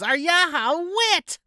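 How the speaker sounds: high, slow squealed punchline; party woman crowing her joke; mock party lady. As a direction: land the joke in a shrill falsetto.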